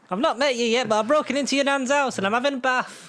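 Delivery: squeaky high-pitched intruder voice; silly high voice. Pretend. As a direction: play a cheeky burglar in a silly high voice. S0 voices a stranger in nan's house.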